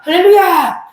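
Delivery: put-on a high, loud child's hallelujah; shouted hallelujah. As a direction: call it out like an eager kid.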